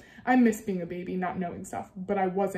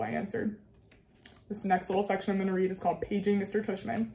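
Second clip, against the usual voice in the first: plain reading voice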